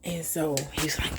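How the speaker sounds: normal talking voice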